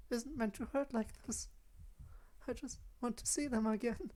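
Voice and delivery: put-on high, soft, timid girl's voice; small, high, halting and hushed; frightened Yuri. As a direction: plead softly in a high, fragile voice. Voices Yuri in visual novel.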